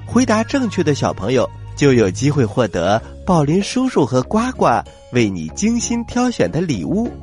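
plain reading voice

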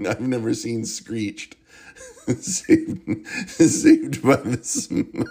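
giggling through the words; can't get it out for laughing; breaking up mid-title. Real, not acted. Cracking up saying the title.

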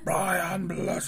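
high, drawn-out theatrical call; Brian Blessed impression. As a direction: boom the name out like a stage actor. Mimics booming actor's name.

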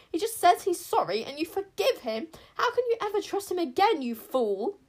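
high, loud furious queen yelling; furious shout. A narrator's reading. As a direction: shout her contempt. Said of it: "raged Queen Sycorax".

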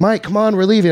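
friends calling. Retold or imitated. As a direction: hurry him along. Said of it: "everybody's like".

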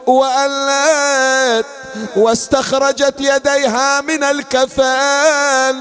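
chanted grieving storytelling; mournful majlis narration; lament recitation. Acted. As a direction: intone the tale as a mourning chant.

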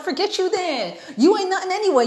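high-pitched brush-off; mock dismissive. Rejected guy to woman who ignored him, mock dismissive. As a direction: mimic a rejected guy's retort.

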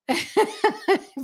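a high burst of laughter. Real, not acted. Woman laughing at her own phone panic, in high laughter. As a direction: laugh high and loud at yourself.

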